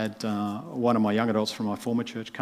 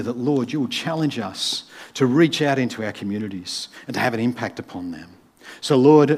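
earnest pastor's prayer, a little louder; closing prayer; 6 dB louder than usual. Acted. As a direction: pray over the congregation with conviction.

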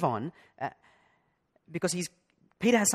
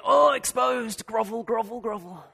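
higher, slow, sheepish mock grovelling; mock embarrassment; 6 semitones higher, 4 dB louder than usual. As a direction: cringe and grovel, fading away. Caught in front of the CEO.

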